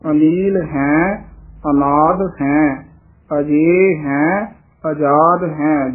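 ਅਨਾਦ and ਆਜ਼ਾਦ slow, ending on d with no i; slow model pronunciation. Shown as a demonstration. Teacher models for reciters, slow ਅਨਾਦ without final i. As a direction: close ਅਨਾਦ on a bare d before a drawn ਹੈ. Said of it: "ਅਨਾਦ ਦੱਦੇ ਦੀ ਸਿਹਾਰੀ ਤੋਂ ਰਹਿਤ".

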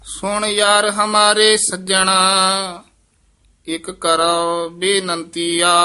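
slow measured chant of scripture; scripture recitation. Acted. Teacher recites Gurbani for students, in a measured chant. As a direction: recite each line with devotion.

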